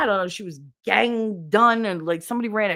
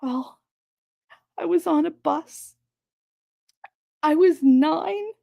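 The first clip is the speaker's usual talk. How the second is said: mock tearful coworker; 8 semitones higher than usual. Mocking the coworker's story to the boss in a pitiful high voice. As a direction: ham up her fragile self-pity.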